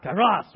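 kairos belted, heavy on the second syllable; gusto. Shown as a demonstration. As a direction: lead the room in a loud kairos. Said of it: "gotta say it with some gusto".

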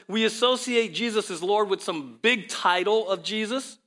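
usual speaking voice

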